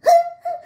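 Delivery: high little animal laugh; high animal giggle; high squeaky giggle. Acted. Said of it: "Atau pada hewan".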